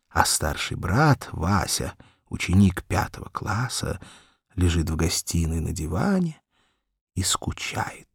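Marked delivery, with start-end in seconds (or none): none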